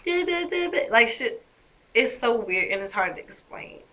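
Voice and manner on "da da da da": put-on high cooing baby talk; mock baby babble, much higher; imitating baby babble. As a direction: babble like an infant. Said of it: "she starts talking".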